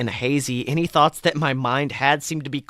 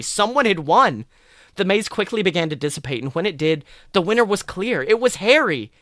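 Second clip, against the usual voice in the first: excited; 6 semitones higher than usual. A narrator's reading. Crowd cheering winner.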